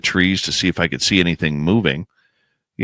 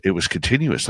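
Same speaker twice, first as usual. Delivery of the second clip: ordinary talk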